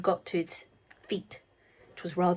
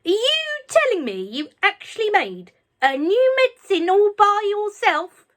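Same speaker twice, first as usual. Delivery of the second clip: shouted; 12 semitones higher, 8 dB louder than usual. A narrator's reading. Grandma shouting down at George from the roof. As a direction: bellow it up from the roof. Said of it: "she shouted".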